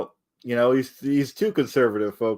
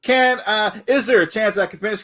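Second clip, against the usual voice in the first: exasperated high demand; 9 semitones higher, 3 dB louder than usual. Real, not acted. Cut off, demanding to finish.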